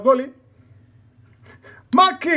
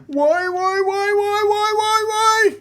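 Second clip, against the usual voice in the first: rising despairing cries; 7 semitones higher, 5 dB louder than usual. Real, not acted. Repeated cries as he loses.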